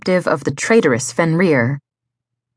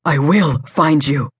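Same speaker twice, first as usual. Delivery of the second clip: firm, determined promise; solemn vow. A narrator's reading. Lover promising the kidnapped woman rescue, vowing. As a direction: swear it to her. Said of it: "Raphael vowed".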